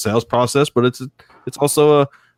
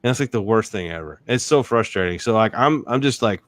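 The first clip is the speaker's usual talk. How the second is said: ordinary talk, nothing marked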